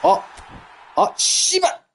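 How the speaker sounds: sharp high angry curse; frustrated outburst. Real, not acted.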